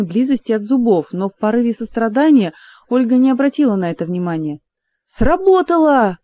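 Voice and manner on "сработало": high, loud, drawn-out squeal of joy; joyful squeal. A narrator's reading. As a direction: squeal it triumphantly. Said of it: "радостно взвизгнула Зинь". (радостный взвизг)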